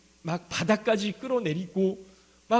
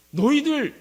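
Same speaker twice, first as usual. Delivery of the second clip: loud, higher rebuke to the congregation; Paul's scolding call; 6 semitones higher, 8 dB louder than usual. Retold or imitated. Paul rebuking proud church members, loud sharp call.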